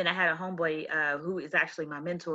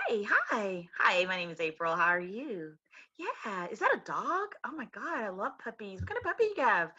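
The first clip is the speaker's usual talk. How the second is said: lightly perky, chirpy sales voice; perky customer voice; 3 semitones higher than usual. Retold or imitated. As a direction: sound bubbly and eager. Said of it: "I would say".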